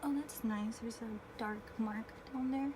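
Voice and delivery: ordinary talk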